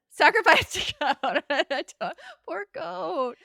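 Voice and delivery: laughing through the words, high and loud. Real, not acted. Host echoes guest's goat joke, laughing. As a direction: crack up while repeating it.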